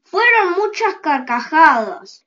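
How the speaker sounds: ordinary delivery, nothing marked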